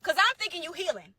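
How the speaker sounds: ordinary talk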